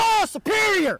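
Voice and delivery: loud high slow demand; shouting. Real, not acted. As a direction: yell it, drawn out.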